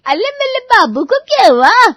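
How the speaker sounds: very high, loud lisping mimicry; mocking baby talk. Asha mimics daughter-in-law fussing over the child, mocking. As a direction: parody her cooing with scorn. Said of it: "करके मेरे सामने नौटंकी कर रही है".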